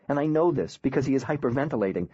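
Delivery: ordinary delivery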